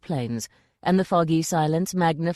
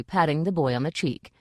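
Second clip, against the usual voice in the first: ordinary delivery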